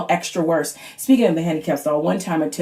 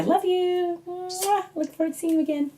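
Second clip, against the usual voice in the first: sweet sign-off; 11 semitones higher, 7 dB quieter than usual. Real, not acted. Sign-off to viewers.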